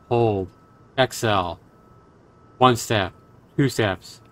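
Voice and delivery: soft, measured storytelling voice; hushed horror narration. Acted. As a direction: read it like a quiet ghost story. Reads horror visual novel text.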